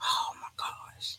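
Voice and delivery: breathy whispered oh my gosh; whispered dismay. Real, not acted. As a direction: whisper it in despair. Reacts to hint, dismayed.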